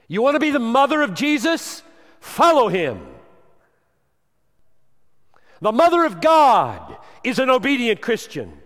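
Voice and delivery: loud, high preaching at full force; thundering sermon voice; fiery preaching. Acted. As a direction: preach it hard to the congregation.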